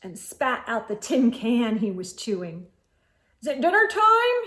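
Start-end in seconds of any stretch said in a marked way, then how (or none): 3.4-4.4 high, bright call from Goat. A narrator's reading. Goat calls out asking if it's dinner time. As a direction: call up to the moose. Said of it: "he called".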